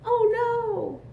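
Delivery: high surprised cry; mock alarm for the kids. Button pops off again.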